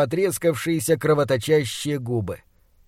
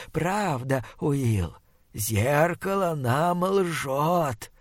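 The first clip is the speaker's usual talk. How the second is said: slow, hushed, rotting monster voice; 6 dB quieter, slower than usual. Acted. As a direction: drag each word like a dying beast. Monster taunts captive.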